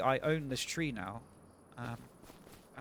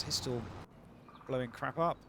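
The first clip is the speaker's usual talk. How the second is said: ordinary talk, nothing marked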